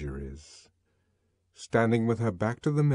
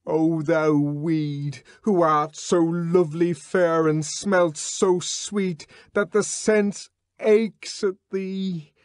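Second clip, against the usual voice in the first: higher, louder, slow declamation; raving savage reciting; 9 semitones higher, 6 dB louder, slower than usual. Acted. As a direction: chant the verses like a madman. John reciting Othello.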